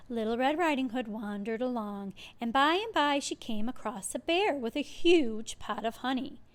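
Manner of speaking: plain reading voice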